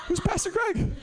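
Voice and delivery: high squeaky disbelief; surprised. Real, not acted. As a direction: react shocked, way up high. Shocked at the card.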